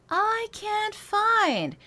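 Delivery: very high, slow spoken line; high speech placement. Shown as a demonstration. Voice coach speaking high to find head voice. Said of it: "Speaking into those upper".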